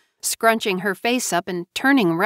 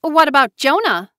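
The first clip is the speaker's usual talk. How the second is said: much higher and louder, sharp cry; shrieked; 7 semitones higher, 6 dB louder than usual. A narrator's reading. Tamara demanding news of Jonah, shrieking. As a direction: shriek it in alarm. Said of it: "Tamara shrieked".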